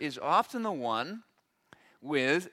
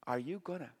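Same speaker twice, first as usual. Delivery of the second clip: whisper; 6 semitones lower, 14 dB quieter than usual. Retold or imitated.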